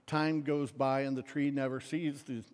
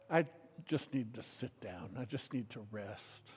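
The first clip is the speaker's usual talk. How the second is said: put-on tired old man; 5 semitones lower, 8 dB quieter than usual. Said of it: "until he's very old".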